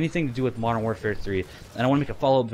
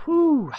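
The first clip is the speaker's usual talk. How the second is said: high whew; 5 dB louder than usual. Acted. Remembering lots of alcohol.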